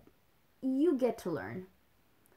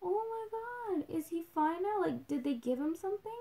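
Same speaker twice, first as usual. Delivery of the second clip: worried surprise; 9 semitones higher than usual. Retold or imitated.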